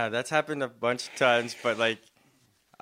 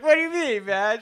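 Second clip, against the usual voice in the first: asking through laughter; high, laughing disbelief; 10 semitones higher, 6 dB louder than usual. Real, not acted. Laughing at retold confrontation.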